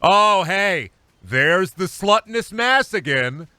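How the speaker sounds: loud then slow, jeering; mocking onlookers. Diners taunting the streamer at Denny's, jeering. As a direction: call it out across the diner.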